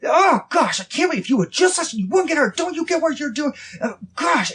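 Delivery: put-on high, loud frustrated scolding of a hurt child; a father snapping at his hurt son; angry parent scolding. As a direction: scold him in a high exasperated voice. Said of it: "and get mad at him".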